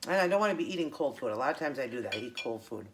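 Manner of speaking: ordinary delivery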